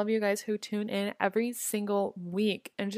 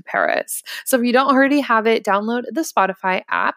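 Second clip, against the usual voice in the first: upbeat sponsor read; 8 dB louder than usual. Acted. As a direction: sell it like a radio ad.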